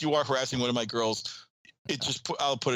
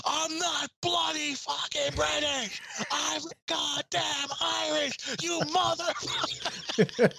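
high, slow, mock-outraged Irish accent; hammed-up Irish rant, pitched way up; heavy Irish accent; much slower than usual. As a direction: imitate an indignant Irishman, stretching every word. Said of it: "the heaviest Irish accent you've ever seen".

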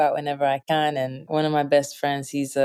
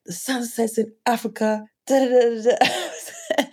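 rising higher and higher as the dad talks up Africa; excited dad; 5 semitones higher than usual. Retold or imitated. Dad boasting to his five-year-old about African sunsets, excited. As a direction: gush about it like a proud, enthusiastic father. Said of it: "But he's like".

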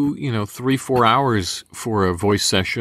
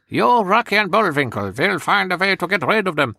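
high Eastern European villain; sneering cartoon bad guy, pitched up; Fearless Leader voice; 9 semitones higher than usual. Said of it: "he was just kind of one of these kind of guys".

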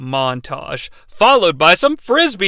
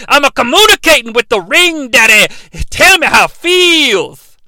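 excitable oddball character; 4 semitones higher, 4 dB louder than usual. Acted. Oddball interrupts to boast of his technique, excitable. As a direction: rant in a put-on voice, swelling to a holler.

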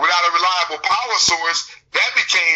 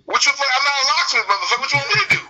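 mock angry retort; 7 semitones higher, faster than usual. Acted retort to a locked-out caller.

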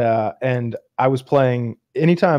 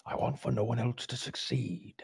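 very soft, breath-only menacing line; whispered film quote; 15 dB quieter than usual. Retold or imitated. Quoting a favorite actor's role, whispered. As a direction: whisper it like a screen villain.

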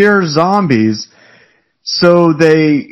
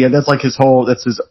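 ordinary delivery

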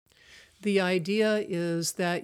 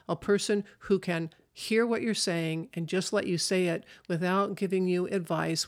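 ordinary delivery

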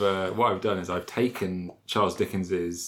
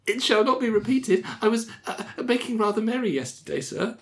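much higher, begging little clerk voice; pleading clerk; 13 semitones higher than usual. Acted. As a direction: plead for mercy in a tiny high voice. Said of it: "pleaded Skunk".

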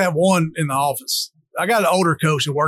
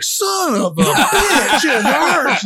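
laughing pained cry; 8 semitones higher, much slower than usual. Retold or imitated. Injured kid allowed to cuss.

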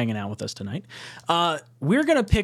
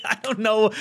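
words broken up by laughter, pitched up; laughing; 7 semitones higher than usual. Real, not acted. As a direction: giggle through the words. Laughing at his own story.

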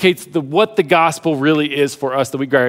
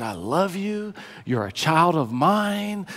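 soft, hushed fatherly declaration of love; tender reassurance; 8 dB quieter than usual. Acted. God speaking tenderly to a believer.

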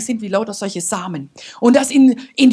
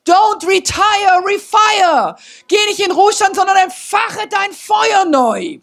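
proclaiming shout; 8 semitones higher, slower than usual. Retold or imitated. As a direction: call it out like a battle cry from heaven. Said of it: "hat der Heilige Geist ihr gesagt".

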